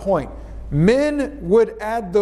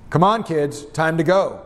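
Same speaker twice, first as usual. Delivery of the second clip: command. Shown as a demonstration. Parent telling kids it's time to go, commanding. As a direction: order the kids to leave, no coaxing. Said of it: "your inflection".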